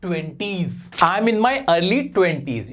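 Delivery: "I am in my early twenties" spoken very slowly and deliberately; slow careful model. Shown as a demonstration. Teacher slowly models a phrase for hiding age. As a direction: draw out each word for learners. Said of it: "आप ऐसे बोल दो".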